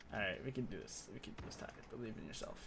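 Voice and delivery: softly, half whispered to himself; quiet self pep talk. Real, not acted. Streamer quietly psyching himself up, hushed. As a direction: coax yourself under your breath.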